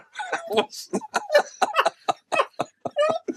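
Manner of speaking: big high burst of laughter; long laugh. Real, not acted. Host laughs at the story.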